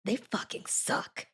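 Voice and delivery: soft, slow, breathy contempt; whispered mock hatred; hushed disgust. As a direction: sneer it quietly. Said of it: "she's like".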